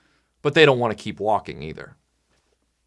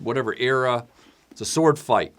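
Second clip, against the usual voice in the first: ordinary talk, nothing marked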